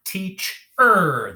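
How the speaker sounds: TEACH-ER drawn out, loud on the ER; "teach-er" in two long, emphatic beats; syllable split. Shown as a demonstration. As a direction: stretch "teach-er" slowly, pushing up the last syllable. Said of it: "the last syllable is er".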